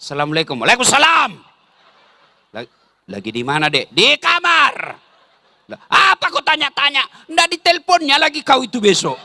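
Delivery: stern wife's reply. Retold or imitated. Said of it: "Coba kalau suamimu tegas".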